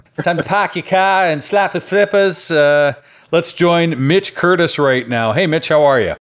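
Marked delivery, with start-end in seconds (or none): 0.1-2.5 hammed-up Boston accent, pitched higher; mock Boston accent. Podcast host introducing a Boston guest, mock Boston accent. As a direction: put on a thick, dropped-R Boston accent. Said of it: "there's no accent there".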